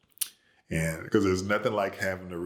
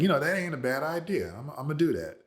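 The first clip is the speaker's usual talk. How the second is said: a touch higher, easygoing acceptance; lightly voiced old man agreeing; mildly hammed-up relaxed father; agreeable grandfather; 8 semitones higher, faster than usual.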